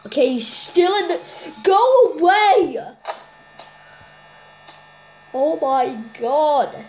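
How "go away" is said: loud slow shout at the door; yelling. Real, not acted. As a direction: yell it out, drawn out.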